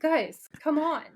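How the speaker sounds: high, drawn-out mock pleading; playful exasperated plea. Host chides the show's heroes for giving up, playfully exasperated. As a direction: whine it slowly at the heroes, half joking.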